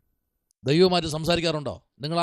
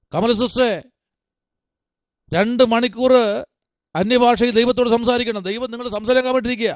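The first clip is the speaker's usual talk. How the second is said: raised pitch, calling members out; insistent high preacher's call; preaching exhortation; 5 semitones higher, 4 dB louder than usual. Real, not acted. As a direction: urge each listener in turn. Calls on members by name.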